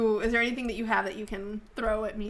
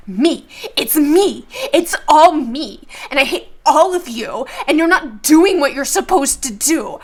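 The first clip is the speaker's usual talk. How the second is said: loud, raised, emphatic tirade; villain rant; 4 semitones higher, 10 dB louder than usual. Acted. Hijacker on screen berates the trapped passengers, shouted rant. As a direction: hurl it out as an unhinged hijacker.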